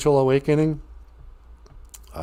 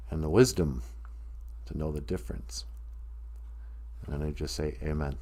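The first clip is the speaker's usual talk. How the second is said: prayer; 4 semitones lower, 7 dB quieter than usual. Acted. Recites Serenity Prayer.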